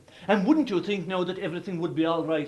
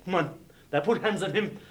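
plain reading voice

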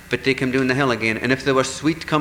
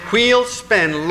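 commanding call; 8 semitones higher, 5 dB louder than usual. Acted. Fairies command the wheel.

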